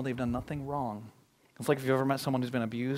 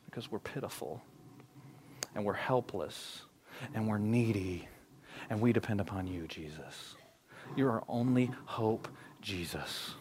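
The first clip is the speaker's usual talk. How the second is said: softer, slow humble plea; pleading; 3 semitones lower, 7 dB quieter than usual. Retold or imitated. Lepers begging Jesus, soft slow plea. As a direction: sink into quiet helpless pleading.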